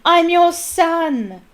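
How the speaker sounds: slow, high, loud anguished scream; a son screaming at his father; drawn-out loud cry. Acted. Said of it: "he screamed up at Crouch".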